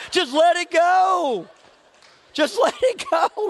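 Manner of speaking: preacher shouts to the congregation to let it go; urging shout. Real, not acted. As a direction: belt it out to the crowd.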